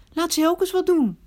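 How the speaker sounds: high, loud flash of temper at the guest; angry outburst. Acted. Hostess loses her temper at the guest, high and loud angry outburst. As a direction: snap it out, losing her temper. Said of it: "Ik werd zo driftig".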